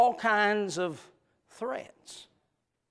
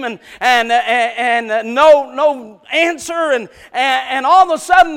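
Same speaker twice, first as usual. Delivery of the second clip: loud, higher storytelling sermon; building suspense from the pulpit; full-force preaching; 8 semitones higher, 13 dB louder than usual. Acted. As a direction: drive the story like a revival preacher.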